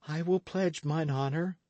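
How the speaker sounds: a timid youth making a pledge; Matcham. A narrator's reading. Matcham speaks.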